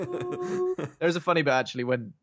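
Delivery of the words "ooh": mock zombie hoot, pitched way up; weird zombie moan. Podcaster repeating the mum's zombie act, weird high "ooh". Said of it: "She's not even actually moaning".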